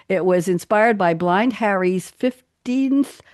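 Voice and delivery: ordinary talk, nothing marked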